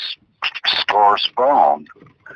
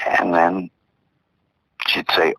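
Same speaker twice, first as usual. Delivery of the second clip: ordinary delivery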